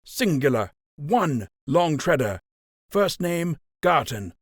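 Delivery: rising anger. A narrator's reading. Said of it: "his own anger rising".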